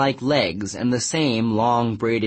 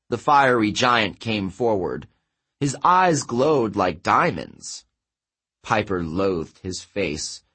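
ordinary delivery, nothing marked

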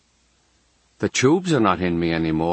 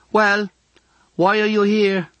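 put-on high-pitched girl calling out; girl's voice; 11 semitones higher, 3 dB louder than usual. A narrator's reading. Girl calling to unseen patient next door, high girlish voice. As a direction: call through to the next room, much higher. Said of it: "a girl's voice says".

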